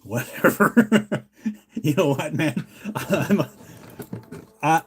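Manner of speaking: words broken up by chuckles; real laughing. Real, not acted. Streamer giving up on politics with viewers, laughing. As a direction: laugh it off while talking.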